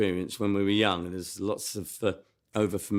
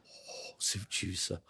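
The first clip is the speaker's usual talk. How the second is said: whispered quote; 4 semitones lower, 13 dB quieter, slower than usual. Retold or imitated. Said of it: "he'd say".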